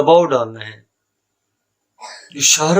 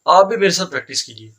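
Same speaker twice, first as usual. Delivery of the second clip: normal talking voice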